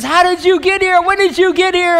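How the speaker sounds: high, loud, eager questioning; excited crowd. Acted. Crowd has just found Jesus after searching, acted excitedly. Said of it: "So they're all excited".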